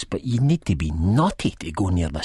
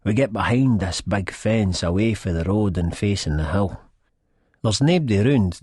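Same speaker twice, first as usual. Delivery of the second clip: ordinary delivery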